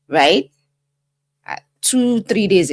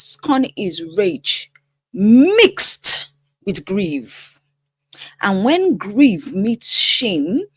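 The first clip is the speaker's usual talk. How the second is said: slow dramatic delivery; slower than usual. Acted. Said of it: "Why am I talking like this?".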